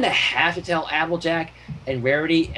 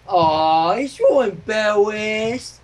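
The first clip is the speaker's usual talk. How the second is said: Pinky cooing; 5 semitones higher, much slower than usual. Acted. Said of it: "Pinky asked".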